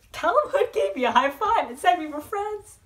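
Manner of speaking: normal talking voice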